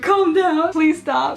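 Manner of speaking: high, loud embarrassed plea; panicked plea. Retold or imitated. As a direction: beg him to stop, flustered. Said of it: "And I was like".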